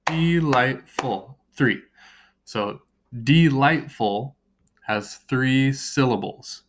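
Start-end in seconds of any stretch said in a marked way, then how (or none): 0.1-1.4 delightful split into de-light-ful, drawn out; delightful broken into three slow, separate beats; syllable by syllable. Shown as a demonstration. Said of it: "How many times did I clap?". 3.2-4.4 delightful split into de-light-ful, drawn out; delightful broken into three slow, separate beats; syllable by syllable. Shown as a demonstration. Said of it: "broken up into three syllables".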